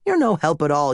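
usual speaking voice

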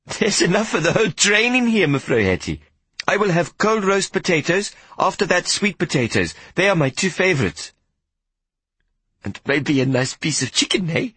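put-on a small boy trying to comfort her; young boy voice. Boy and Hetty chatting.

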